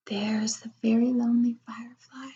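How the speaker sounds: soft pitying whisper. Acted. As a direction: whisper it tenderly.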